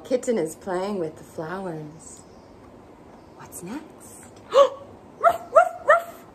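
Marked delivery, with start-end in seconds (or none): 4.5-6.3 very high, loud playful barks; barking dog. Acted. Storyteller doing the dog for toddlers, high loud barks. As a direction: bark like a little cartoon dog. Dog sound in kids' book.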